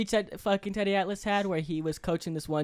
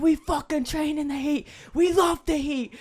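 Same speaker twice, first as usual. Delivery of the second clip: high, slow, drawn-out hype; slow pumped coach speech; 9 semitones higher, slower than usual. Retold or imitated. Coach repeating the fireman speech, slow and high. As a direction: lean on every word like a fired-up coach.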